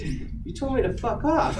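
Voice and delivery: high-pitched mock indignation with laughter; retold indignant protest. Employee confronting the manager next day, indignant and amused. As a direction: protest in disbelief, half laughing.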